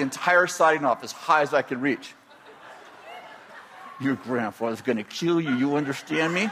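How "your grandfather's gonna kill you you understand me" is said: lower, softer ominous mom; mother threatening her son; stern mother. Retold or imitated. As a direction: warn him quietly. Mother warns child.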